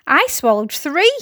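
mildly excited one-upping boast; boastful cry. A narrator's reading. Centipede outdoing the earthworm, crying out boastfully. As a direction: top the others with pride. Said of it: "the centipede cried".

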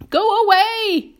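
high, loud, drawn-out yell; frightened shout. A narrator's reading. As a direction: shout to drive off the shark. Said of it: "they shouted".

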